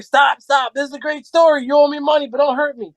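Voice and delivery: mock panicked pleading. Running round the pool table from the angry SEAL, mock pleading. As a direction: plead for mercy half laughing at yourself.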